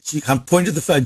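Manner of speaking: ordinary talk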